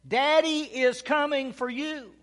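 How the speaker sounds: high, loud drawn-out call from above; re-enacted father's long-distance shout; retold yell. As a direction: holler slowly to a lost child far below. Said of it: "I yelled out".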